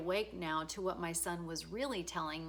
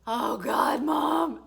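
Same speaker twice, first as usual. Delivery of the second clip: embarrassed son; 8 semitones higher, 11 dB louder, much slower than usual. Retold or imitated. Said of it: "he's gonna be like".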